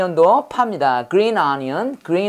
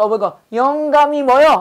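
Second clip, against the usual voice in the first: mishearing voice; 6 semitones higher, 3 dB louder than usual. Retold or imitated. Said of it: "영어에서".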